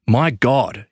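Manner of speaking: ordinary delivery, nothing marked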